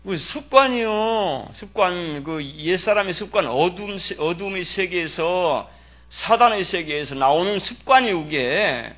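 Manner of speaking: slow, loud, drawn-out preaching; emphatic preaching. Acted. Pastor preaching to the congregation, slow and emphatic. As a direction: lay each word down slowly for the congregation.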